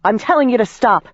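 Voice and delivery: high, commanding shout to stop; firm warning shout; shouted order. A narrator's reading. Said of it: "I shouted".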